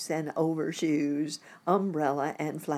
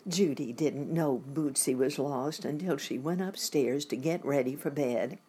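usual speaking voice

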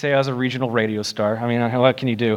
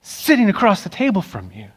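voicing Jesus earnestly; 5 semitones higher than usual.

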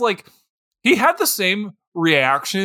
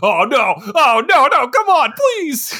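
rising, louder pleading climbing high; mock panic; 7 semitones higher, 5 dB louder than usual. Man pleading with the guard, rising into high panicked begging. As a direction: beg in rising panic, slowing on please. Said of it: "you just see the guy go".

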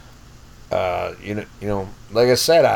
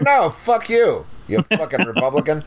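mock comeback; 4 semitones higher than usual. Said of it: "I'm like".

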